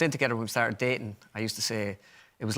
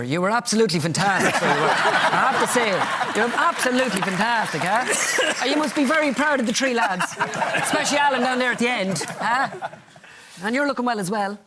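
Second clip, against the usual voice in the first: gushing host; 9 semitones higher, 5 dB louder than usual. Acted. Gushing at Bernard Senior.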